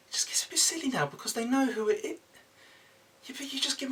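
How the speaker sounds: whispered aside off camera. Real, not acted. Mark whispering to the crew behind the camera, whispered. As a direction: whisper it so the viewers miss it. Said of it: "aside to crew".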